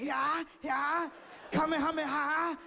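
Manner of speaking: high, desperate battle cries; anime attack shout. Retold or imitated. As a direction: yell it like a cartoon fighter's special move. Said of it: "doing stuff I see on T V".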